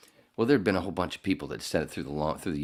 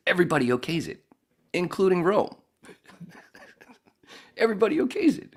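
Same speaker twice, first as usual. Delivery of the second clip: excited; 14 semitones higher, 5 dB louder than usual. Retold or imitated. Excited retelling, rising.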